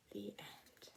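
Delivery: soft unvoiced whisper; hushed closing whisper. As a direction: whisper the ending so no one wakes. Closing the bedtime story.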